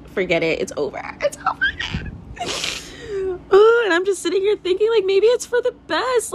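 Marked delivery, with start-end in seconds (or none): 1.2-1.6 It's over jumped way up high in a dramatic cry; hammed-up heartbroken squeal; mock wail. Mock despair repeat. 1.6-3.5 high crying laugh; half laugh, half mock sob. Real, not acted. Laughing cry after wail. 3.6-6.3 high, fast, squeaky fake-bright musing; hammed-up squeaky resignation; mock squeaky voice. Squeaky mock musing after wail.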